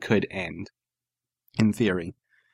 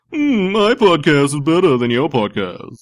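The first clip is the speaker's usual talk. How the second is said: higher, louder snooty British put-on; haughty British caricature, playing superior; 5 semitones higher, 4 dB louder than usual. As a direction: sneer it in a mock British accent. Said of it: "snooty British voice you just did".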